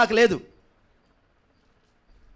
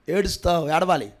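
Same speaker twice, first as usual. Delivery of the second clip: ordinary talk, nothing marked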